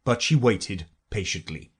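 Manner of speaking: ordinary delivery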